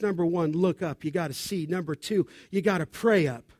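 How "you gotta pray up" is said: usual speaking voice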